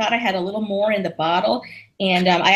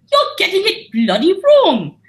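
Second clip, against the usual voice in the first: high, slow, bad British accent; bad English accent; 11 semitones higher, 5 dB louder than usual. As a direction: ham up a terrible posh English accent. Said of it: "my English accent is fucking awful".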